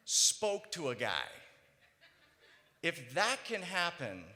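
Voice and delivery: loud, slow, emphatic preaching. Acted. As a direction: drive each phrase home to the crowd. Preaching to the audience.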